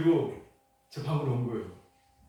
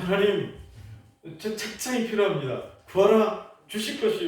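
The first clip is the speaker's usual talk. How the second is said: higher, louder pleading prayer to God; insistent prayer; 7 semitones higher, 7 dB louder than usual. Retold or imitated. Pastor pleading loudly with God for a bookcase, insistent. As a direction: press God hard for the bookcase.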